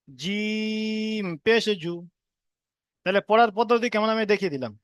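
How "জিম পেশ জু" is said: জিম held long and slow; drawn-out recitation. Shown as a demonstration. Teacher draws out the letter name for the class. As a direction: stretch the জিম before the syllable. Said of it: "জিম প্রথমে টান দিয়ে দেবো".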